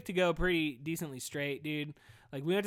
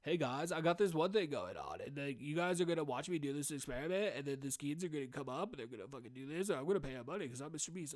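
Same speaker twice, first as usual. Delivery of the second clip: a half-hearted YouTuber voice, softer, speeding up at the end; lightly imitating a hyped video host; Mister Beast impression; 2 semitones lower, 6 dB quieter than usual. Podcaster mocking Mister Beast videos, weak impression. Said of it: "I can't do fucking impressions".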